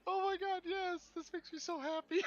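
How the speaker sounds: high, delighted squeal of words; overjoyed. Real, not acted. Player sees their other character turn up, thrilled and squealing.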